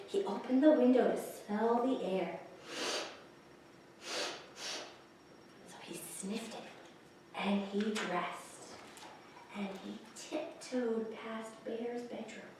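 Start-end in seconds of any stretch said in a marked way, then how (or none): 4.0-12.5 hushed story whisper; whispering so as not to wake Bear. A narrator's reading. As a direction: breathe it so Bear won't wake. Mole tiptoes past sleeping Bear.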